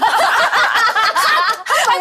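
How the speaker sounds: high-pitched burst of laughter; real laugh. Real, not acted. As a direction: laugh instead of starting to sing. Laughs when asked to sing.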